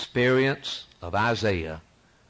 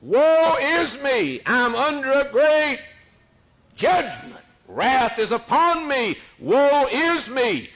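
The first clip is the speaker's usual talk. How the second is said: high, slow anguished cry of woe; 13 semitones higher, 6 dB louder, slower than usual. Acted. Preacher voicing Isaiah's cry before God, loud anguished wail. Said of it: "And he cried".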